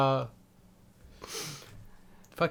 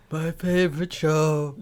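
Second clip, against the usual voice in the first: put-on very old man. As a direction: drawl it like a dying grandpa. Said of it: "fun to do an old man voice".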